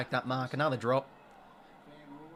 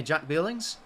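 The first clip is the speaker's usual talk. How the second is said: normal talking voice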